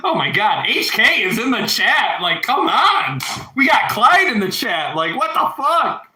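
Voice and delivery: real astonished excitement, pitch soaring by the end; excited disbelief. Real, not acted. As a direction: gush in amazed delight. Amazed friend joined the chat.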